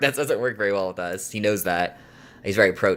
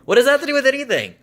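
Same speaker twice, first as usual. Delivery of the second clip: much higher, louder and fast, in disbelief; incredulous outburst; 6 dB louder, faster than usual. Real, not acted. Baffled at the boyfriend's remark, shot out high and loud. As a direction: fling the question out, baffled and fed up.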